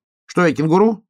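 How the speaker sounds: high, loud, offended hedgehog; high-pitched affronted question; indignant hedgehog. Acted. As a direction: squeak it in indignation.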